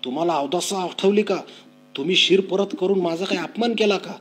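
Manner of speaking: high, loud angry yelling; angry shout. Acted. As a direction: roar at his father in fury. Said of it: "तो बापावर ओरडला". (रागाने जोरात ओरडून)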